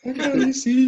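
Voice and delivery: put-on high dazed boy voice; stoned boy. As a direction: squeak it like the wasted kid. Repeating the boy's line.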